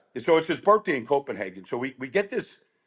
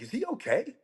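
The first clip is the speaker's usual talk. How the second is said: quiet worried; 9 semitones higher, 9 dB quieter than usual. Retold or imitated. Worried aside to the manager.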